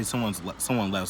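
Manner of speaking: ordinary delivery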